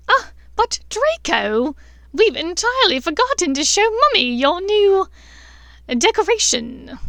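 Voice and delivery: a cruel aunt playing sweet; loud mocking sing-song; taunting Bellatrix.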